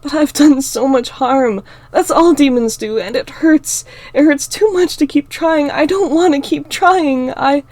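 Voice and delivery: high, loud sobbing despair; breaking down. Acted. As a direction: cry it out, losing hope. Heroine breaking down in tears.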